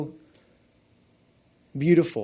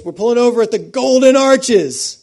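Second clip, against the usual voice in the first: dad calling back; 8 semitones higher, 11 dB louder than usual. Retold or imitated. Dad's reply quoted.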